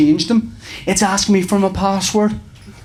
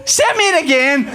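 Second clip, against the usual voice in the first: exasperated; 12 semitones higher than usual. Retold or imitated. Exasperated at support again.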